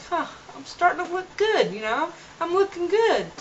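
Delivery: high-pitched, happily impressed with yourself; pleasantly surprised. Retold or imitated. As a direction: react as if pleased by the mirror. Retold pleased reaction to mirror.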